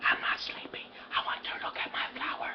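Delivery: put-on little girl whispering back in bed. Sick Zuzu whispers to her father about her flower. Sick child answering at bedtime.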